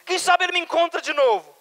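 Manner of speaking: high, loud cry of hope; hopeful shout. Acted. Peter's hope acted out, shouted. (grito agudo de esperança)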